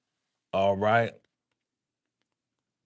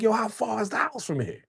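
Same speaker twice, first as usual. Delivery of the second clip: high, exasperated mock question; whiny, restless complaint; impatient; 10 semitones higher, 4 dB quieter than usual. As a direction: act fed up with the long drive. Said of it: "I'm like".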